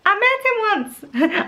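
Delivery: high-pitched attempt at Scottish; bad Scottish accent. Mum reading The Gruffalo's Child, high bad Scottish accent. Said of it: "read it in a Scottish accent".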